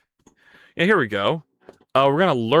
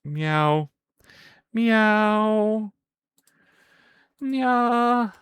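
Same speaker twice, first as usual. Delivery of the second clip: cat meow; 7 semitones higher, much slower than usual. Pretend. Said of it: "why don't you ever meow this much".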